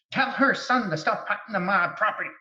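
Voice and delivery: a hint of a stern, possessive old woman; angry ghost woman. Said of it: "she sounded just like that".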